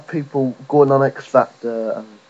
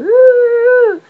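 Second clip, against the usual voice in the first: very high, loud held squeal; mock sympathetic wail; 10 dB louder than usual. Comic imitates the voters reading the paper, high mocking wail. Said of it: "people are gonna go".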